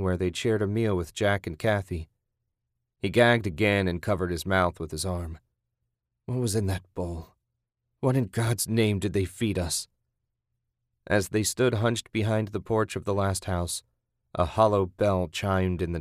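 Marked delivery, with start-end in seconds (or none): none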